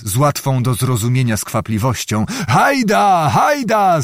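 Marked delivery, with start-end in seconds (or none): 2.5-4.0 high, rousing holler; calling out. A narrator's reading. Zagłoba waving the guards to the barrels, calling out. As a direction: urge them on with a high call. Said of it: "zawołał Zagłoba".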